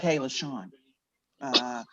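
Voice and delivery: low, soft hesitant hello; awkward boss voice. Retold or imitated. Boss on Zoom softly greeting before firing her. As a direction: ease into bad news softly. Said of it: "They're like".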